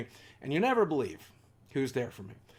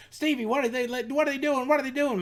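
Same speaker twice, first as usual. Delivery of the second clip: much higher panicked cries of a mother; frightened mother's mock scream; 12 semitones higher, 4 dB louder than usual. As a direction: voice mom calling out in fear, higher. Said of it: "she's screaming on the hallway".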